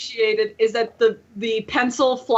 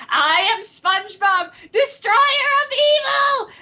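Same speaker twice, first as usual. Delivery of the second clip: rising higher and higher, mock heroic; heroic SpongeBob; 11 semitones higher than usual. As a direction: build it up into a triumphant cry. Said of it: "he's like".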